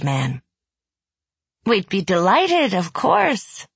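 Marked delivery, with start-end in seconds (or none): 1.6-3.7 forced cheer through clenched teeth; sweetly through gritted teeth. A narrator's reading. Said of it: "Nina said through gritted teeth".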